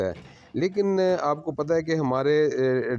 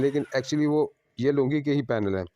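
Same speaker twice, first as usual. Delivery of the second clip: ordinary delivery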